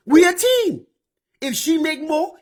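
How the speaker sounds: ordinary talk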